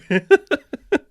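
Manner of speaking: high burst of laughter; sudden high laugh. Real, not acted. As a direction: crack up at the joke. Laughs at own joke.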